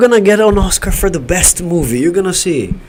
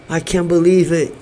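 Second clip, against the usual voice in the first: ordinary talk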